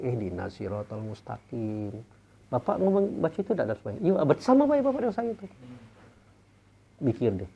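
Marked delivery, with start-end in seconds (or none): 0.0-1.9 prayer recitation. Shown as a demonstration. Quotes his own prayer recitation.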